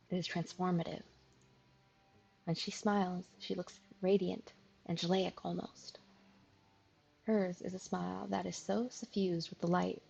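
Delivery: calm, measured storyteller read; story narration. A narrator's reading. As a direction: draw the listener into the scene. Reads visual novel narration.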